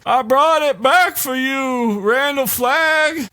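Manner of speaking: high, slow, eerie sing-song; creepy damaged man. Retold or imitated. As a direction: croon it like a broken devotee. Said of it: "he's just kinda like".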